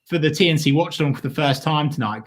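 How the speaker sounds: ordinary delivery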